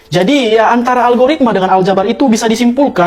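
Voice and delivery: ordinary talk, nothing marked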